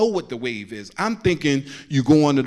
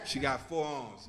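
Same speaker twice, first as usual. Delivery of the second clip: hushed punchline; 14 dB quieter than usual. Acted.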